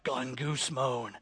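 low, soft, slow grumbled gongusmon; grumbling guy. Retold or imitated. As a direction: mutter gongusmon like a sulky complainer. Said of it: "some guy was like".

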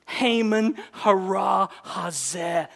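"Haman hara hazeh" very slow, every syllable hit hard; each syllable stressed. Shown as a demonstration. Esther accusing Haman at dinner, every syllable hammered. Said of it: "emphasizing each syllable".

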